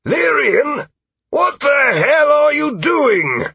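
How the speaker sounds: high, loud, outraged yell; ringing shout. Acted. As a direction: bellow it slowly across the field. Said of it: "Sir Frederick Aldwin's voice rang out".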